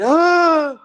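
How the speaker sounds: sharp high loud cry of pain; imitating a fighter's cry as a body shot lands; imitated pained yelp. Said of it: "You can hear this kid".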